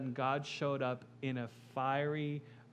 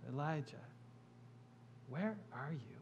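gentle whisper of God; 9 dB quieter than usual. Acted. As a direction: call to Elijah in a hushed, gentle voice. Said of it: "God gently whispers".

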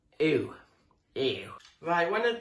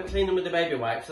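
usual speaking voice

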